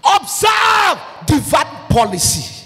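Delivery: high, slow, shouted command; preached shout. Real, not acted. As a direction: thunder the command at the congregation.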